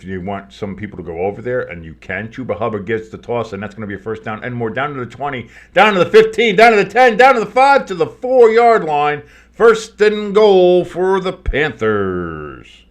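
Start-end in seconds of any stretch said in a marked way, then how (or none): 4.7-12.9 building high loud excited call; excited play-by-play. Acted. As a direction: shout it as he runs. Excited call of a long run.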